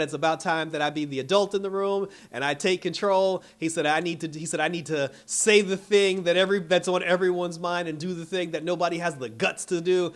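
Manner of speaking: impassioned mock speech; fed-up rant. Retold fed-up rant by Joker.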